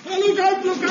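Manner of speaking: high urgent warning cry; shouting a warning. Acted. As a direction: yell it out in alarm. Warning shout at falling water.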